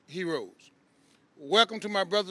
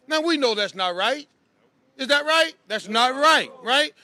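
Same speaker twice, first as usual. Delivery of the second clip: loud; 3 semitones higher, 5 dB louder than usual. Real, not acted. Rally call and response.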